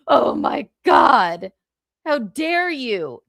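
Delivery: hammed-up scandalized gasping protest, stretched out; exaggerated shocked indignation; mock outrage. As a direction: imitate someone utterly affronted, slow and loud. Mimics outraged people's reaction.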